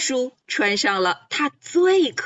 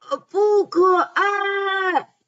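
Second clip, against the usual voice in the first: 大嗓门喊; 6 semitones higher, slower than usual. A narrator's reading. 短尾矮袋鼠大声喊. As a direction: belt it out slowly. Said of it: "大着嗓门儿喊".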